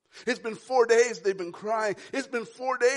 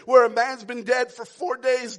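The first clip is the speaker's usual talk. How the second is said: ordinary delivery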